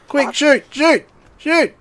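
high, hurried cries of shoot; urgent panic. Real, not acted. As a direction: urge yourself on in a fright. Wolf charging, urging to shoot.

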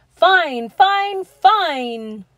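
high, loud chant; cheering shout. A narrator's reading. Children and teachers cheering their principal, loud shout. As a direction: cheer it out joyfully. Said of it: "teacher shout".

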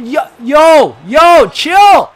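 loud, rising objection to the ref; protesting shout. Real, not acted. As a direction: call out to make the ref back off.

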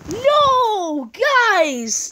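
loud desperate "No!" shouts, pitch high; frustrated shouting. Real, not acted. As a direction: yell no at the screen as the level fails. Failing a level, shouting.

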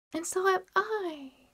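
put-on high, slow, eager child's cry; a little one chiming in; child's exclamation. A narrator's reading. A small Cratchit child echoes Peter, high eager exclaim. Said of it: "exclaimed another".

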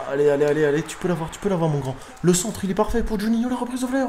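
commentaire qui monte jusqu'au cri aigu; encouragement excité. Real, not acted. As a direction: pousser l'attaque, de plus en plus haut. Encourage ses joueurs en pleine attaque. (encouragement qui s'emballe)

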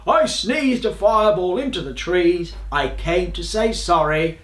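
indignant beast, building to a high finish; roaring dragon. A narrator's reading. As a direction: plead the case loudly as an aggrieved dragon. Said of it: "he roared".

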